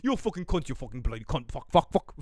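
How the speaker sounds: mock chef swearing spree, not shouted; a hint of a ranting celebrity chef; Gordon Ramsay. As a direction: lightly imitate a cursing British chef.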